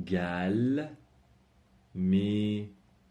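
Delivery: میوروم stretched out very slowly; slow model word. Shown as a demonstration. Teacher slowly spells out the negative for students, drawn out. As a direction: drag out میوروم syllable by syllable.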